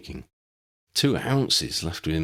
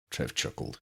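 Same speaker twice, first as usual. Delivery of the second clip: plain reading voice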